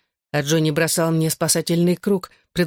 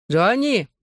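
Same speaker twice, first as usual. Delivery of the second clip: higher-pitched call by name; 5 semitones higher than usual. A narrator's reading. Another guy calls to Johnny, calling out. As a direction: call a friend over, without a real shout. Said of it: "позвал его другой парень".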